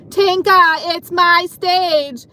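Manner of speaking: imitating a rude coworker, loud and high; mock yell, high-pitched drawn-out demand. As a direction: holler it like her, dragging out the last words. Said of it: "started to raise her voice".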